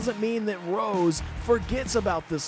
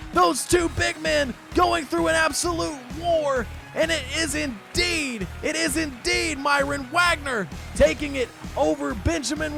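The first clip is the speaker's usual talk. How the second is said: raised, high-pitched wrestling commentary; excited match recap; 8 semitones higher than usual. Real, not acted. Commentator telling folks of a shock defeat, excited. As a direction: keep the thrill of the finish while summing up.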